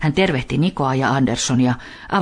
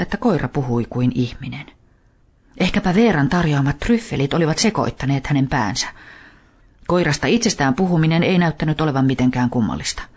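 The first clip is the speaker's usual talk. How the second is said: plain reading voice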